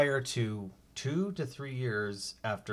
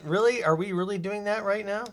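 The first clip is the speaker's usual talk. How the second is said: sharp rising disbelief, pitched up; incredulous; 7 semitones higher than usual. Real, not acted. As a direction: scoff at the idea. Mock outrage at comparison.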